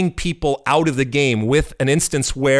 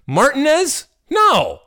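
exasperated rant; 12 semitones higher, 4 dB louder than usual. Real, not acted. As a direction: pitch jumps way up, louder, fed-up outburst. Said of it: "it does drive me crazy".

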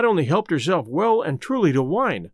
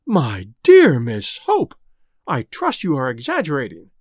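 shocked exclamation; 5 semitones lower, 4 dB louder than usual. Acted. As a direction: gasp it out in dismay. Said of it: "exclaimed the Quabaris in unison".

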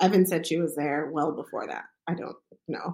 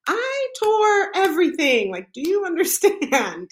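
exasperated laughing; 12 semitones higher, 6 dB louder, slower than usual. Retold or imitated.

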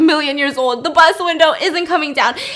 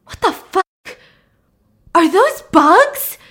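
high, drawn-out imitated shock; horrified disbelief; 6 semitones higher, 4 dB louder, slower than usual. Charlotte spotting bugs in the throw up, horrified disbelief. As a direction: stretch it out in appalled disbelief. Friend discovers maggots in vomit.

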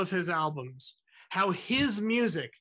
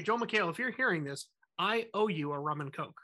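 ordinary delivery

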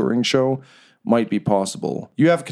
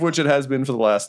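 ordinary talk, nothing marked

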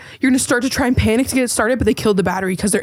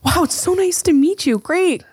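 high, gushing hello; hammed-up polite enthusiasm; mock friendly greeting; 8 semitones higher, slower than usual. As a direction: greet the visitor with put-on delight. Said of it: "and you guys be like".

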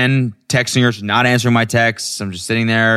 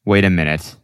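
ordinary delivery

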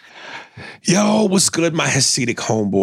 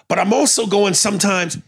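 ordinary talk, nothing marked

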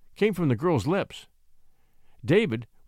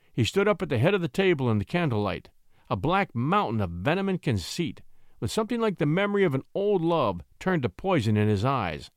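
usual speaking voice

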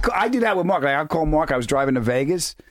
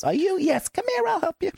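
very high, gushing fan lady; high-pitched starstruck bank teller. Retold or imitated. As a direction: squeal it like an excited fan. Bank lady fan voiced in story.

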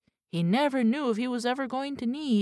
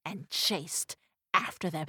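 plain reading voice

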